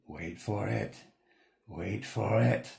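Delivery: slow, hushed anticipation; companion's voice. Acted. Companion beside the player, hushed and slow anticipation. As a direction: hold them back, savoring the moment. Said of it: "the guy next to you goes".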